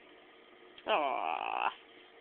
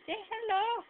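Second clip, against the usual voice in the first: much higher, rising on hello; 13 semitones higher than usual. Real, not acted. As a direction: coo hello up high to a pet. Baby talk to the cat.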